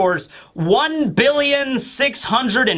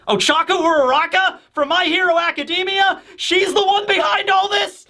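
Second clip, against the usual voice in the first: mock shock; 10 semitones higher than usual. Real, not acted. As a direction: gasp out the name in disbelief.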